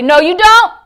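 loud, high, emphatic refusal; forceful imitation of a bold preacher; bold rebuke. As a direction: rebuke it boldly. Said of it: "this is how he talks".

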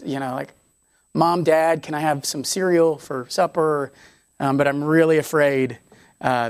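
a hint of a scared child afraid to ask; timid child asking. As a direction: play a nervous kid muttering his worry. Child voiced asking parents for food.

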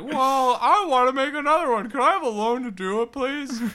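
very high, loud, quick pleading; hammed-up high-pitched begging customer; silly loan applicant. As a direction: squeal a loan request like an overeager kid.

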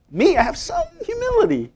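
high prissy self-praise; mock pious boast, pitched high; smug mock voice. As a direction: parody a proud man bragging of humility. Mocking the self-righteous.

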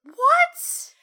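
high, loud shocked "what?". Real, not acted. Co-host hears the DVDs lack the episode, shocked. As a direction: burst out in disbelief.

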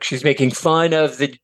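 ordinary talk

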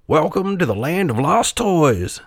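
high, bouncy toy voice; lively jack-in-the-box. A narrator's reading. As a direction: welcome with showman's bounce. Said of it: "said lively Jack-in-the-Box".

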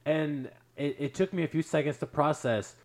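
normal talking voice

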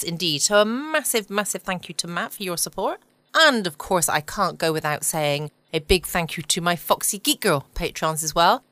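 ordinary delivery

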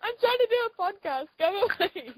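high, loud shout to go away; annoyed shout. Real, not acted. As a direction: yell at him to leave. Berries thrown, shooing friend away.

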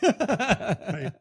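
laughing. Real, not acted. Laughing at co-host's joke.

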